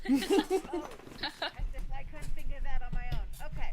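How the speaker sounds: loud, indignant scoff. Acted. Rogue scoffing loudly at the sleepy fighter's advice.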